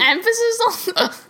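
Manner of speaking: giggling through words; breaking up laughing; laughing hard. Real, not acted. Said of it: "Do you have the hiccups".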